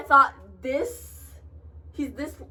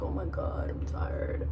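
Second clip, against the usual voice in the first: whispered, much softer and drained; tired whisper; 10 dB quieter than usual. Retold or imitated. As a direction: breathe it out, worn out after work. After long work shift.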